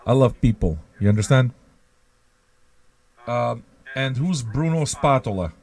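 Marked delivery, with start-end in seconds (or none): none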